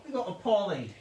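put-on high amateur British accent; attempted British accent. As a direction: introduce cats in a silly British voice. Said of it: "do it in a British accent".

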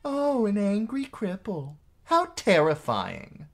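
high mock-frightened sneer. A narrator's reading. Sister scoffing at her angry brother, mocking. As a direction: ridicule him in a high, drawn-out voice. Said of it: "She laughed".

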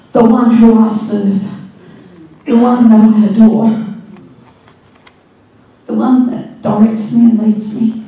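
loud, fervent worship declaration; preaching at full force. Acted. Pastor declaring devotion to the congregation, loud and fervent. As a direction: cry it out in adoration.

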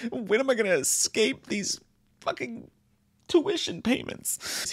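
high stretched-out whine; high whining complaint. Pretend. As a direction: whine it in mock despair. Joke whine about tuition.

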